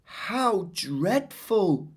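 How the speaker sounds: high, very slow horrified words; shocked. Acted. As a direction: draw it out in dismay. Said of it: "said Amajit".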